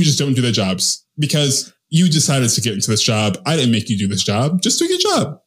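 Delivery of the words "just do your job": high pitched fed-up plea; exasperated demand. Real, not acted. Ranting about lazy waiters, exasperated high plea.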